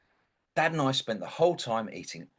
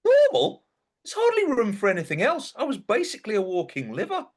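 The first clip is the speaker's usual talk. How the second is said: much higher and louder, indignant; outraged disbelief; 10 semitones higher, 6 dB louder than usual. Acted. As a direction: throw the word back in outrage. Protests the liver is normal.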